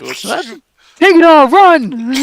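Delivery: high, loud playful getaway cry; gleeful pretend call to flee; mock excited shout. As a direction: yell it like a joking robber fleeing. Player jokes about robbing the shop.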